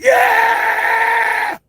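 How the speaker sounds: huge excited yell; joyful yell. Retold or imitated. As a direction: leap up yelling with joy. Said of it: "my ass was like".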